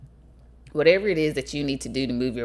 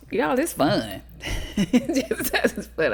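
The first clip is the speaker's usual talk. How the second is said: laughing; 10 semitones higher, slower than usual. Real, not acted. Amused insisting jazzercise is fun.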